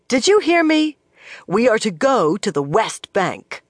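high, loud, insistent demand; indignant demand. Acted. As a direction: make him answer you. Swan demanding of the bannerman.

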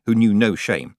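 ordinary delivery, nothing marked

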